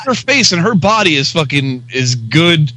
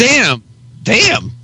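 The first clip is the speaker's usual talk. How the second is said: impressed damn; 8 semitones higher than usual. Real, not acted. Reacting to Helen Mirren photo.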